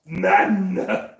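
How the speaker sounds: loud, drawn-out Muscle Man voice; cartoon jock accent, played loud; Muscle Man impression. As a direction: do Muscle Man's brash catchphrase. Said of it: "Muscle Man's accent is the one thing I can do".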